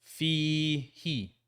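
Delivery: Fi long, he short; long then short. Shown as a demonstration. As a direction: Fi stretched, he clipped. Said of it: "then ha with a short kasra".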